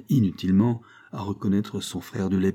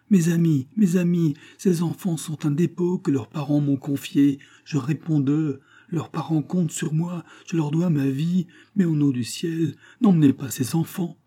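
high-pitched, insistent supplication; urgent pleading; 8 semitones higher than usual. A narrator's reading. Principal answering for children entrusted to him, pleading urgently. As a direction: beg them in a raised, higher voice. Kneeling principal begs the crowd.

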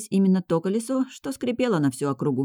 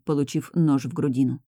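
ordinary delivery

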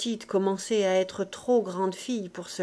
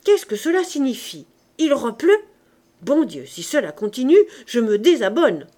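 loud, theatrical protest; raised voice, punchy complaint; indignant exclamation; 3 semitones higher, 7 dB louder than usual. A narrator's reading. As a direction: cry out in mock outrage at it. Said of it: "s'écria Gavroche".

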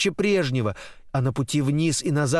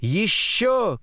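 high, stretched demand for more; eager cry; 6 semitones higher than usual. A narrator's reading. Girl on a swing wants more, eager cry. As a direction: call out high for another go. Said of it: "кричит она".

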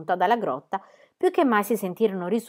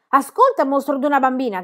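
high, loud furious yell; shouting; 6 semitones higher, 6 dB louder than usual. A narrator's reading. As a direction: bellow at the brat. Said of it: "gridò".

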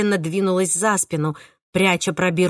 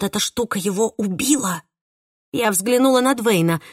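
ordinary delivery

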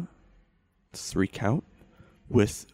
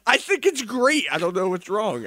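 much higher, louder hurt whine; whiny defensive; 8 dB louder than usual. Acted. Host scolded by producer, high hurt whine. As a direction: defend yourself in a hurt squeak.

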